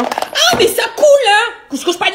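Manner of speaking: high startled yelps; sudden high shriek of surprise; surprised shriek. Real, not acted. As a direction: squeal as the water splashes you. Doll leaks water on her.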